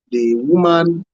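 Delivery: ordinary talk, nothing marked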